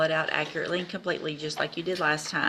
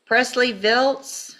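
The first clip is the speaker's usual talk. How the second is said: loud, slow, drawn-out call across the room; calling out a name; 7 semitones higher, 7 dB louder, much slower than usual. Real, not acted. Court clerk calling next case.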